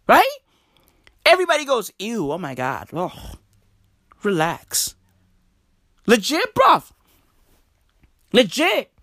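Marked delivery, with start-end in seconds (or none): 2.0-4.9 quiet, lowered disgusted aside; mock disgust. Everybody goes ew at a burp, mock disgust. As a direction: imitate an onlooker softly put off. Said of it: "Everybody fucking gets disgusted".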